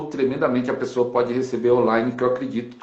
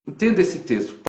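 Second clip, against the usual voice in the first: ordinary talk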